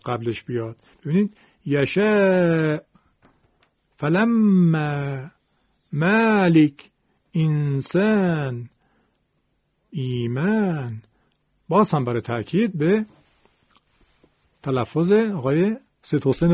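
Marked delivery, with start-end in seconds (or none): 1.7-11.0 slow examples with long, fronted, thin ā; light, thinned alif. Shown as a demonstration. Teacher giving tarqiq examples, long light alifs. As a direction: stretch each ā lightly, never thickened, word by word.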